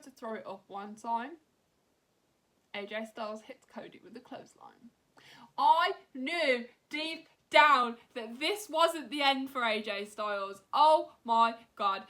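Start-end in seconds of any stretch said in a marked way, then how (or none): 5.6-12.0 loud, slow, high-pitched gush of disbelief; excited outburst. Real, not acted. Fan telling viewers about the betrayal, loud excited disbelief. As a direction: let it burst out as the twist hits. AJ's surprise attack on Cody.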